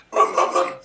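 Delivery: rough, loud vroom without voicing; imitated engine noise. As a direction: make a car noise with the mouth. Imitates the Batmobile driving off.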